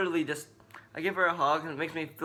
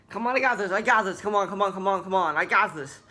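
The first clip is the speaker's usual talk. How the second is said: louder, higher, pushing yourself through it; psyching yourself up under stress; urgent loud mantra to get through the task; frustrated self pep talk; 4 semitones higher, 6 dB louder than usual. Retold or imitated. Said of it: "That's how I feel when I'm frustrated".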